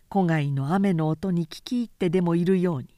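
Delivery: ordinary delivery, nothing marked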